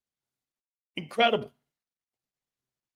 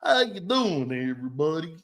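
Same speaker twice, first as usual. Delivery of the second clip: fake Cajun accent; 2 semitones lower than usual. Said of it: "phony-ass accents".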